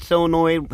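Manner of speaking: normal talking voice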